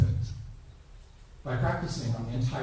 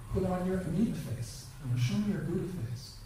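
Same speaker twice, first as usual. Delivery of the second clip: gentle prompt; 6 semitones higher, 4 dB quieter than usual. Shown as a demonstration. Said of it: "then I ask them as the first lesson".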